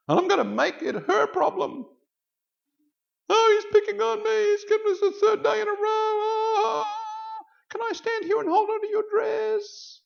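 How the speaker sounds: very high, loud mock bawling; crying, whingeing child. Boy complains to the teacher about the bully, hammed-up high wail. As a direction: play the crybaby clinging to teacher, squealing. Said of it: "do some more crying".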